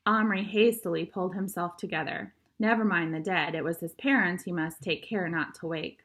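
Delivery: plain reading voice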